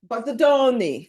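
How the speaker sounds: mock-Italian flourish, slow and high; playful Italian accent. Shown as a demonstration. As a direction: sing out the name like an Italian, pitch going up. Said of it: "it sounds very Italian".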